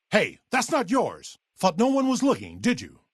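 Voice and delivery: high, indignant challenge; accusing call. Acted. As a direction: catch a thief in the act. Catching a thief.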